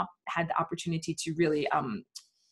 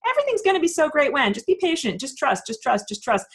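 much higher, louder and fast, sing-song false promise; mock empty reassurance; 8 semitones higher, 4 dB louder than usual. Mocking Q stringing followers along, sing-song and high. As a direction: coo it like a huckster stalling for time.

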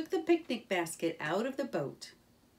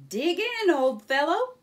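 high, loud, jolly invitation; hearty Rat; 9 semitones higher, 8 dB louder than usual. Acted. Rat invites Mole to the picnic, high and hearty. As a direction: Rat urges his friend to dig in.

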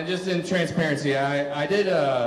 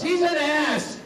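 knowing dismissal; 9 semitones higher, slower than usual. Retold or imitated. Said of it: "repeat of earlier bit".

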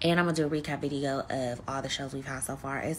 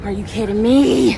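loud, slow, exasperated disbelief; 6 semitones higher, 14 dB louder than usual. Real, not acted. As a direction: let real anger burst out. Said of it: "I'm so livid".

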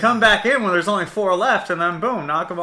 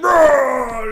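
high, loud drawn-out roar; imitated roar; 7 semitones higher, 4 dB louder than usual. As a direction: roar like a charging wrestler. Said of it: "you're like".

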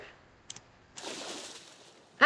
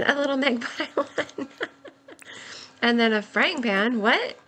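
normal talking voice

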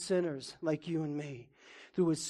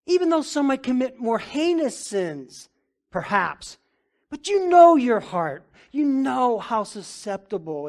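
preaching climax; 6 semitones higher, 9 dB louder than usual. Real, not acted. Rising sermon climax.

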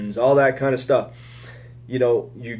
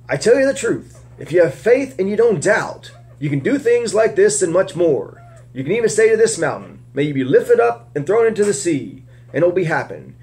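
raised, earnest reading voice; scripture reading; 6 semitones higher than usual. Acted. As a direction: read the Gospel story aloud to the group. Reading Jesus' words on faith.

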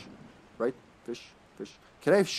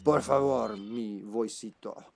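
lightly played raspy Spanish character; 3 semitones lower, slower than usual. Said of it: "my raspy Spanish voice".

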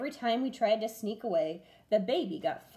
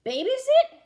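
much higher, louder disbelieving squawk; shocked pirate; 10 dB louder than usual. A narrator's reading. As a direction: echo it in horror. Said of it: "You'll have to help me babysit".

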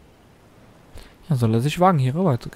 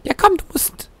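mock high pushy voice; 12 semitones higher than usual. Gamer goading the stuck computer, high mock voice. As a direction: hurry the opponent along in a silly high voice. Pushing opponent to move.

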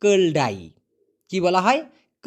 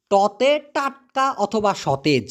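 slow and clear; much slower than usual. Shown as a demonstration. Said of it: "slow letter announcement".

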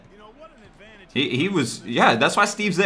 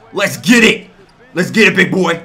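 hype shout; 3 semitones higher, 8 dB louder than usual. Real, not acted. Gamer hyping his fast break, loud shout. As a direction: fire up the team.

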